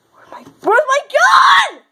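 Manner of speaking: high, slow yell of alarm; panicked shout. Pretend. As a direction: bellow for the lost gun. Toy sheriff finds gun missing.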